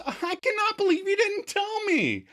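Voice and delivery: high hammed-up whining Rachel; whiny Rachel. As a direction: whine it like a wounded girlfriend. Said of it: "And Rachel whines".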